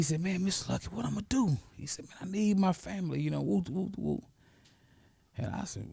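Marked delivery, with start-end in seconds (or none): 0.2-4.2 high, soft plea; lightly voiced desperate young husband; pleading student. Former student pleading with his mentor, high and soft.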